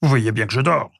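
grumbling caveman disturbed in sleep. A narrator's reading. Sleepy prehistoric man to unwelcome visitors, grumbling. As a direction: grumble crossly at the intruders. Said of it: "grogna l'homme à ses deux visiteurs".

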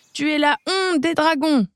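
high scolding cry; mild mock yell. Dragon king scolds Baluchon, a mild high yell. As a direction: scold him as a disappointed king. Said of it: "hurle Furibon".

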